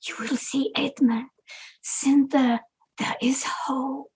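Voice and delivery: slow whisper full of hope; hopeful whisper. Acted. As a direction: whisper the promise slowly. Secret promise to soldier.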